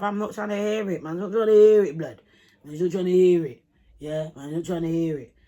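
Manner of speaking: loud, fed-up retort; angry refusal. Retold or imitated. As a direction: shut him down, hammering the repeats. Acting out her refusal in quarrel.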